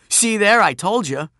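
loud, high I-told-you-so; triumphant crowing. Acted. Narrator proved right before the bullies, loud triumph. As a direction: crow at being backed up.

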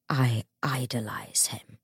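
slow, hushed, sorrowful murmur. A narrator's reading. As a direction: say it softly and low, full of sorrow. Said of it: "said Matthew sorrowfully".

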